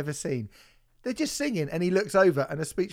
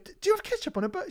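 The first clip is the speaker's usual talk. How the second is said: put-on 1 girlfriend's baffled question; much faster than usual. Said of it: "and she was going".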